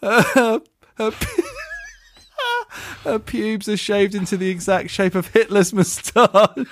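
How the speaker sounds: high, slow, squeaking through giggles; helpless laughter. Real, not acted. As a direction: fight to get the punchline out. Losing it reading the punchline.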